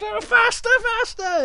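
very high, excited squeal; eager cub's cry. Acted. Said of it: "and he goes".

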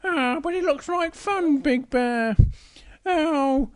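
high-pitched protesting cub; Little Bear. Acted. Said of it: "Big Bear says to Little Bear".

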